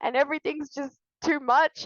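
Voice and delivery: high, loud, hammed-up weepy complaint; mock teary wail. Imagining herself arriving to the group still crying, mock weepy. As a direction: play the overwhelmed crier for laughs, half-joking. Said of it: "worried that I was gonna come in here and still cry".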